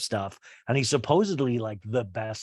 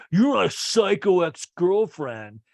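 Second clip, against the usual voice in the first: higher, louder, slow hammed-up accusation; mock angry accusation; 7 semitones higher, 6 dB louder, slower than usual. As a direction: fling the insult like a spurned ex, dragged out. Craig's flashback insult quoted.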